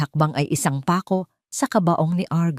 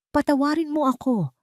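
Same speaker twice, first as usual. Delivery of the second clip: higher, tearful begging; sobbing plea; 9 semitones higher than usual. A narrator's reading. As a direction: beg for forgiveness through sobs. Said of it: "humagulgol si Argus". (humahagulgol na pagmamakaawa)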